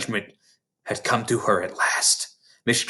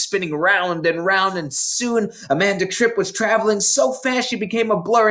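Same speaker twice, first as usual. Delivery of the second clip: ordinary delivery, nothing marked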